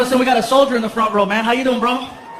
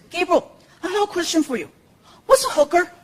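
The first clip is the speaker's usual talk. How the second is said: put-on high-pitched kid voice; innocent little boy; 7 semitones higher than usual. Son asks stepdad in kitchen, high innocent voice. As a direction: ask it like a curious small child.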